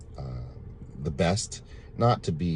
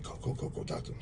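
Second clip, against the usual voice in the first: manager whispering; 11 dB quieter than usual. Retold or imitated. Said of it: "my manager said".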